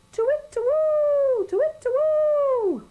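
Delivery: very high, loud slow hoot; owl hoot. A narrator's reading. Owl calling in the night garden, very high hoot. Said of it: "went the owl".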